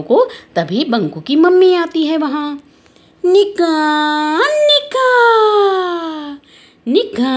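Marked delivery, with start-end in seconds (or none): 3.2-7.4 sing-out summons, sustained vowels; calling out. Acted. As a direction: call someone from a distance, stretching the name. Mother calls out from afar.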